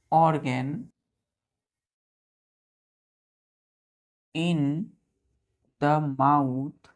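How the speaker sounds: word by word, with long pauses; measured, drawn-out classroom dictation; slow dictation. Real, not acted. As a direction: dictate slowly for pupils writing it down. Dictates the definition while writing.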